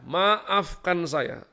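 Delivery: "maafkan saya" stretched very slowly; drawn-out apology. Real, not acted. Preacher repeating his apology to the congregation, very slowly. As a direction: drag out "maafkan saya" for emphasis.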